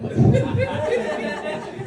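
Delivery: a real laugh, pitched high, at his own punchline. Real, not acted. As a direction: laugh out at the joke.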